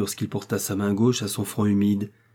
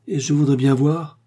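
louder, higher, indignant challenge; mildly menacing, raised voice; fierce warning; 5 semitones higher, 5 dB louder than usual. A narrator's reading. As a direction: dare anyone to try it. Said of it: "d'un air terrible".

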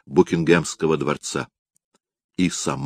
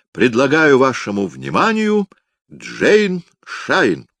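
loud carnival barker; 7 semitones higher than usual. Acted. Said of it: "в манере ярмарочных зазывал".

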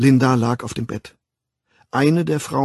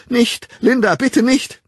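high, loud, panicked plea; angstvolles Flehen; 13 semitones higher, 7 dB louder than usual. A narrator's reading. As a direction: beg desperately to be spared. Child begs not to be hit. (hoch und laut anflehen)

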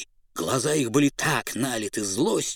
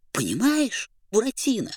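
high Pierrot voice; 10 semitones higher, 3 dB louder than usual. Acted. Pierrot tells story.

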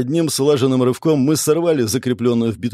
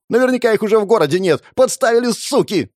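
fast, much higher, agitated outburst; betrayed fury; 10 semitones higher, 3 dB louder than usual. A narrator's reading. Man realises his colleagues framed him, furious. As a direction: rage at being set up.

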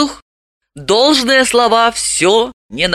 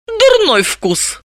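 slow, spiteful, much higher; spiteful jab; slower than usual. Acted. Dalila spitefully mocks Vanik's taste. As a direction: twist the knife with glee. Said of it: "Далила зловредно добавила".

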